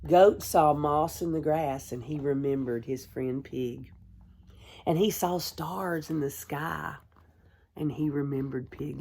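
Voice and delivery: ordinary delivery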